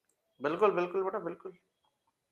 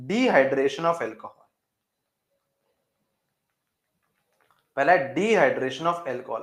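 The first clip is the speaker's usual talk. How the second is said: slow dictation; much slower than usual. Shown as a demonstration. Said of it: "teacher dictating the heading".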